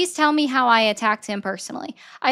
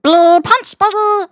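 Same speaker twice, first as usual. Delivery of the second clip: Stitch voice; 7 semitones higher, 6 dB louder, much slower than usual. Shown as a demonstration. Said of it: "talking like Stitch".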